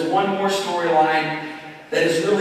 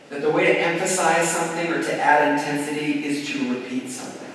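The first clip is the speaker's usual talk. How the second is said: usual speaking voice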